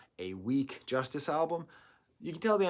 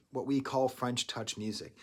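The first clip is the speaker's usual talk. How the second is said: normal talking voice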